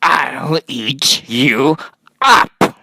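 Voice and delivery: playful monster threat ending in a shout; growling threat. A narrator's reading. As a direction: drag it out, then explode on the last word. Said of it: "And Max said".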